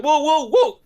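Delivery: high, loud Brooklyn-guy "whoa"; imitating Joey. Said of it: "then Joey's like".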